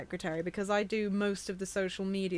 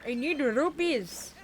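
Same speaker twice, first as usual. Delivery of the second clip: drawn-out, higher mock plea; playful cry; 6 semitones higher, 3 dB louder, slower than usual. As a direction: stretch it out in a raised voice. Both chanting for rupees in game.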